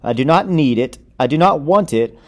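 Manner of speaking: ordinary delivery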